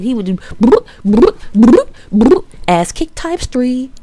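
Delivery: playful imitated game hits; mock combo sound effects. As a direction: beat out the combo noises like a game.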